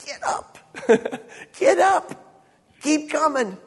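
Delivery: high-pitched urging through a laugh; calling out encouragement. Acted. Jesus calling to those fallen flat, high urging. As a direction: act out calling someone up off the floor. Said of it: "he's calling us".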